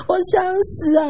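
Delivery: high, feeble plea; a thin, tired wail; weak dying voice. Retold or imitated. Sick friend to her visitor, feebly moaning she wants to die. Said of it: "真的这种声音啊".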